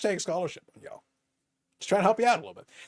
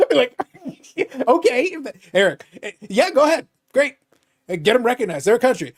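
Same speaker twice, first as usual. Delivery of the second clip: höher und lauter, lachend aufgedreht; laughing excited rant; 7 semitones higher, 6 dB louder than usual. Retold or imitated. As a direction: ramp it up, giggling at the idea. Mock scheming, laughing, loud.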